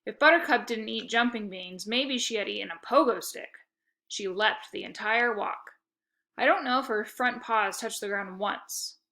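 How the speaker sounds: plain reading voice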